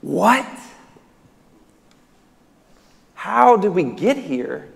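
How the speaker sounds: incredulous. Acted. Preacher reacting to the crowd's demand, incredulous. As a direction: ask it as if utterly baffled.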